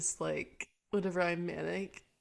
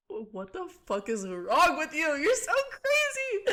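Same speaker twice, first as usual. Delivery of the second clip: mock self-scolding; 9 semitones higher, 4 dB louder, slower than usual. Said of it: "sit yourself down and be like".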